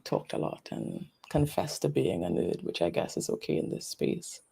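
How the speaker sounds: drops to a whisper, almost unvoiced; whispered aside. Real, not acted. Joking about being a nerd, a whispered aside. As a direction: confide it under your breath like a private joke.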